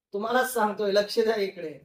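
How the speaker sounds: ordinary talk, nothing marked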